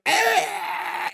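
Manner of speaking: high, drawn-out imitated screech; sudden high cry for the hard stop. Driver stopping hard at the red light, imitated screech. Imitates sound as car stops.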